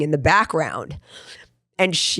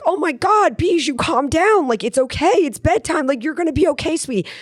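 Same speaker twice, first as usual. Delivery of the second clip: much higher, urgent soothing; frantic mom; 12 semitones higher than usual. Retold or imitated. Mom pleading with daughter Bijou to calm down, high frantic voice. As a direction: try to calm a panicking child. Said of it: "And I would be like".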